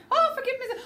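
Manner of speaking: high, loud rushed plea; as fast as possible. Shown as a demonstration. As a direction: demonstrate a frantic apology. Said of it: "as fast as you can, so proper like".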